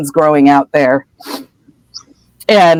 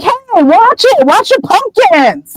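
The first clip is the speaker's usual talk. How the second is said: high, drawn-out warning, much higher than usual; alarmed Hagrid; slower than usual. Retold or imitated. Hagrid warning Ron off the pumpkins, high and alarmed. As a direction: yelp it, stretched and slow. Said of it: "And Hagrid's like".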